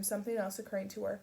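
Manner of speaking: plain reading voice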